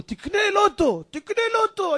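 high, insistent sing-out; nagging pest. Acted. Acquaintance keeps urging pauper to buy lotto, high nagging. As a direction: pester him with the same high refrain. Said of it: "כל הזמן אומר לו".

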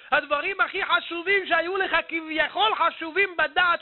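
high, ringing exhortation; fervent, high-pitched proclamation; impassioned preaching. Real, not acted. Preacher drives the moral home.

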